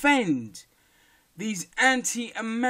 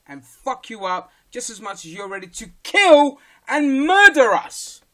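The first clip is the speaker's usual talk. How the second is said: furious shout; 4 semitones higher, 8 dB louder than usual. Real, not acted. Ranting at right-wing critics.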